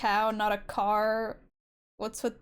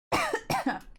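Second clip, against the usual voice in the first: high sharp cough from dust; 12 semitones higher than usual. Real, not acted. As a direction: cough out the dust in your throat. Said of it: "I got dust in my throat".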